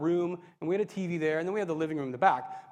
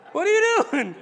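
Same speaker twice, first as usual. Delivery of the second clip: high, loud comic outburst; exclaimed question; 13 semitones higher, 9 dB louder than usual. Retold or imitated. Replaying God's question to the teen, comically exclaimed. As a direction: blow the question up into a mock shout.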